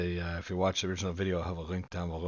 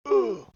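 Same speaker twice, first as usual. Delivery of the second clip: surprised ooh; 14 semitones higher, 12 dB louder than usual. Real, not acted.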